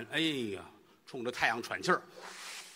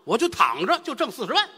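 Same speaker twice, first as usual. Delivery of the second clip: high, loud gloating over easy money; gleeful; 12 semitones higher, 7 dB louder than usual. Acted. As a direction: crow with delight at the windfall. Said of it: "于老师高兴".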